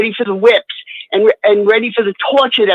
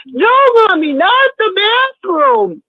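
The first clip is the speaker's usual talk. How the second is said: imitated child screaming; 12 semitones higher than usual. Mother retelling children dragged off for punishment, imitated screaming. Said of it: "you'd hear them screaming".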